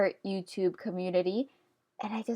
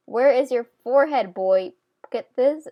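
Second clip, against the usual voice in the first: playful loud scolding; 3 semitones higher, 7 dB louder than usual. Real, not acted. Scolding the drawing again.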